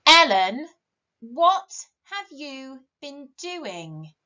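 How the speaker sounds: loud shocked call of a name, then slow; a mother finding a mess, stretching out each word; storybook mum scolding, loud then slow; cross mum. Acted. Mum discovers messy bathroom.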